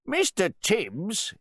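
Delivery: loud, high, drawn-out mock address; jeering, pompous announcement; mocking host. A narrator's reading. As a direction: make fun of the butler before guests. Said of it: "the host began to mock his butler".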